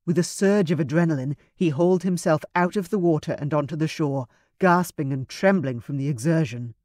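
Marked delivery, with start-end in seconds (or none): none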